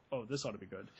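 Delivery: deep mocking aside voice; low sarcastic heckler. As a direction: drop low and sneer it. Sarcastic interjection voice.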